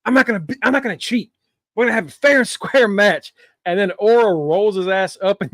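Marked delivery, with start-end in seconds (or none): 0.0-3.3 mock wrestler quote. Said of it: "He's like".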